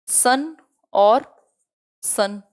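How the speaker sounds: slow teacher demo of a homophone pair; slow word demo. Shown as a demonstration. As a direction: stretch out सन और so each sound is heard. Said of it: "spelling अलग है".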